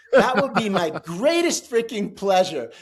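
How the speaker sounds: loud, high mock delight with laughter; sarcastic relish. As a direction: throw it back with gleeful sarcasm. Sarcastic answer to a threat.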